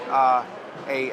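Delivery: normal talking voice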